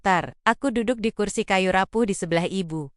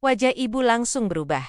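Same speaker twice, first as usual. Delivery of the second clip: usual speaking voice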